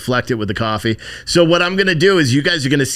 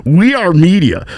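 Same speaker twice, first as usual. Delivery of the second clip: higher, louder whiny protest; mocking the reporters, higher and louder; mocking media voice; 5 semitones higher, 6 dB louder than usual. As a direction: play the indignant reporter. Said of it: "And they're like".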